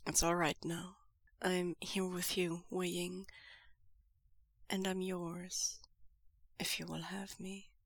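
quiet reassuring whisper-soft lover; hushed comfort. Acted. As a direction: soothe him gently. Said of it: "Lan Zhan hushes him".

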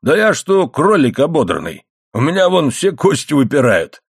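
lightly voiced bickering protest; indignant protest. A narrator's reading. Husband protests to wife that he is skinny, mildly indignant.

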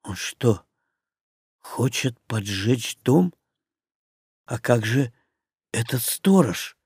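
soft, low stunned inner voice; thinking in quiet horror; shocked whisper of thought. A narrator's reading.

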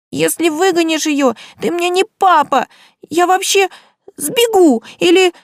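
put-on high, loud child's shriek; a little girl screaming at her father. A narrator's reading. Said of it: "визжит Алёнушка".

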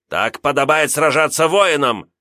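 loud proud growl. Acted. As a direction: growl it back defiantly. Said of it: "рыкнул он в ответ".

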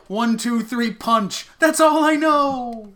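high, loud dumb boxer counting punches; hammed-up dumb boxer. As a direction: bellow it like a boxer who only knows punching.

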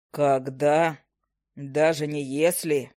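low, slow gnome voice; gruff gnome. Acted. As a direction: correct her bluntly.

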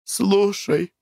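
high tearful outburst; tearful cry. A narrator's reading. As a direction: cry it out through tears. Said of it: "слезливо крикнула".

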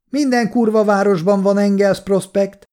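high-pitched dismissive call. A narrator's reading. Soldier dismisses Kolja's address from outside, calling back. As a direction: shout the objection across the yard. Soldiers keep shouting across to house.

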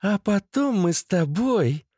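high female voice, wheedling; coaxing girlfriend. A narrator's reading. Masha promising Pusik something afterwards, high coaxing voice. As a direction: pitch it up and entice him.

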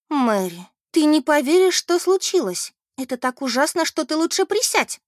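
high, loud melodramatic announcement; milk the dreadful news for drama; mournful proclamation. A narrator's reading. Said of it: "скорбно провозгласила".

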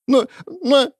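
high, sulky protesting stammer; a high, pouting girl's stammer; sulky croak. A narrator's reading. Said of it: "квакало надувшаяся девочка".

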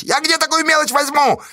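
very high, strained wail; yelling. A narrator's reading. Fedka asking where to get change, wailing yell. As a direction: wail it in outraged dismay. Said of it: "завопил Федька".

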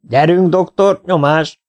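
high, drawn-out urging yell. A narrator's reading. Teammate urges the floating doctor on, yelling. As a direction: holler to hurry him on. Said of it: "ordította Faragó".

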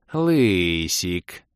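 drawn-out, coaxing coo; demoness cooing. A narrator's reading. Demoness calls to the bald elf, cooing. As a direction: coo the pet name seductively. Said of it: "проворковала демоница".

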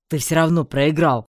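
loud, forceful challenge; sudden interjection. A narrator's reading. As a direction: burst in loudly. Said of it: "неожиданно вмешался орк".

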